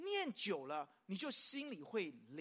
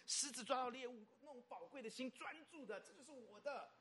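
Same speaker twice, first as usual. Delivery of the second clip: softer, higher, treasuring tone; hushed possessiveness; 4 semitones higher, 8 dB quieter than usual. Acted. Preacher acting a lion clutching its prey, hushed and possessive. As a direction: hush it like guarding something precious.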